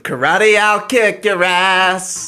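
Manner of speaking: loud, slow, high aggressive rant; belligerent drunk. Acted. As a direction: bellow threats like a rowdy drunk. Aggressive mock rap lyrics.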